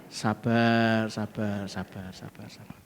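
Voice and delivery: soft fading sabar. Retold or imitated. Mocking self-soothing.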